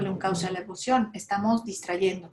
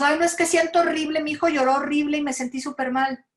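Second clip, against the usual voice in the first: queja exagerada; 6 semitones higher, 5 dB louder than usual. Retold or imitated. Padre quejándose, imitado.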